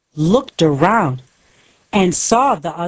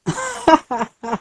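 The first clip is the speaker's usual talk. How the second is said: a short villain laugh, pitched way up; high mocking cackle; 11 semitones higher than usual. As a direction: cackle with scorn.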